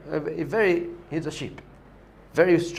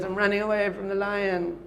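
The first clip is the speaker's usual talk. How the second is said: sheepish lion cub; 6 semitones higher than usual. Acted.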